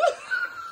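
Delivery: very high squeal of laughter. Real, not acted. Shrieking with laughter at how old the book is. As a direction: let a shocked laugh squeal out. Shock at thirty years.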